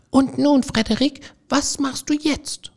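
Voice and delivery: small field mice calling up to Frederik; squeaky mouse voices. A narrator's reading. The mice ask idle Frederik, in high squeaky voices.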